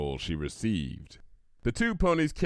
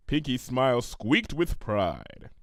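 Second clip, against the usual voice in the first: plain reading voice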